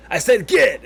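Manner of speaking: sharp, loud angry order; angry vendor. Acted. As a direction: bark it at a pest to chase it off. Vendor chasing off the kobold.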